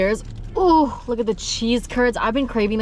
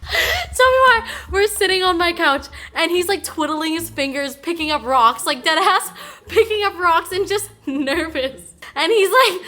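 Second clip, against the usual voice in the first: laughing high and loud through the story; 8 semitones higher, 4 dB louder than usual. Real, not acted. Telling her viewers about her boyfriend, laughing high and loud. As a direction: crack up while telling it.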